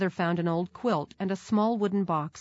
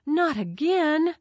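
high, drawn-out cry of dismay; pained, high-pitched exclamation; dismayed gasp; 10 semitones higher, 5 dB louder, slower than usual. A narrator's reading. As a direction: cry out slowly and loudly, sick at heart. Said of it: "Howard gasped, sick at heart".

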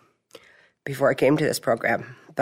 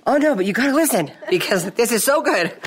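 eager pleading; 9 semitones higher than usual. Retold or imitated. Urging the eye-rolling president to listen, high and eager. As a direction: press on eagerly, won't be stopped. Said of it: "And I'm like".

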